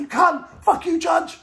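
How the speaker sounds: high-pitched shouted abuse; acted angry outburst. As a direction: yell insults while being dragged off. Grabbed by security, insulting the judge.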